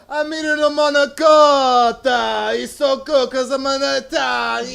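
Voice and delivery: loud, high, drawn-out mock Italian comic; Maniscalco impression. Comic mocking Italian acts with a terrible, loud Maniscalco impression. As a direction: belt out a hammed-up Italian caricature, stretching the words. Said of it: "my terrible Maniscalco impression".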